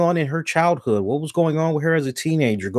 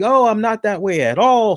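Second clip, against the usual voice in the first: fake innocent; 7 semitones higher than usual. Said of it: "you need to be like".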